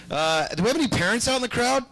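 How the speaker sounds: ordinary talk, nothing marked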